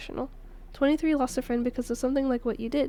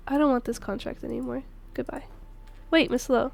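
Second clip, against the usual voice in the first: normal talking voice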